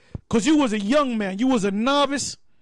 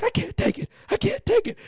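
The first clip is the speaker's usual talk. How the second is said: panicked recruit; 8 semitones higher than usual. Retold or imitated. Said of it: "they were like".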